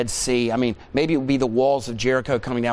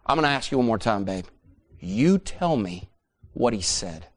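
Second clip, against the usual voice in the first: lowered, slowing warning; stern ultimatum; 4 semitones lower than usual. Acted. Wife asking husband one last time, sternly controlled. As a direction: drop the voice and stretch out the demand.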